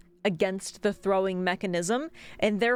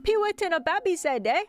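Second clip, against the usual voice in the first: market hawker; 6 semitones higher than usual. Pretend. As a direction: call out the wares like a street vendor. Streamer hawks like a market seller.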